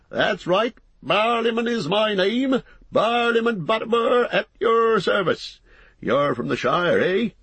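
high-pitched, hearty landlord's greeting; bustling innkeeper. Acted. Landlord to a newly arrived hobbit, bustling welcome. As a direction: greet the guest in a high, lively character voice.

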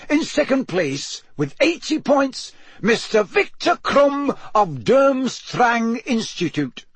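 high, ringing master of ceremonies; magnified announcer. A narrator's reading. Bagman announces the standings to the stands, magnified announcer voice. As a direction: project to the stands, pitched high and drawn out. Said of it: "his magically magnified voice echoed".

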